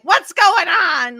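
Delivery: slow, loud, tipsy demand; drunk and loud. Retold or imitated. Drunk Megan barging into the loft, loud and slow. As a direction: bellow it like a drunk party girl. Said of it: "she's all drunk and loud".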